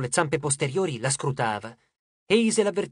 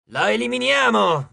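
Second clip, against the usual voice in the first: loud, high, eager shout; raised voice, pitched up high; shouted battle cry; 6 semitones higher, 5 dB louder than usual. A narrator's reading. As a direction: cry it out like a call to attack. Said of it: "gridò Hedge".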